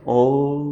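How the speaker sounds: "ओ" held long and low, closing into a hum; sustained Om. Shown as a demonstration. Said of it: "इसका उच्चारण कर देते हैं".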